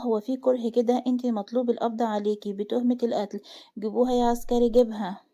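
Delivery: ordinary delivery, nothing marked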